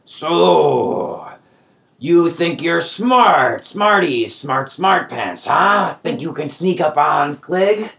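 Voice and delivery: high, slow sneering goblin voice; goblin taunt. Acted. Goblin gloating at captured adventurers, high sneering taunt. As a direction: sneer at the heroes like a cocky goblin.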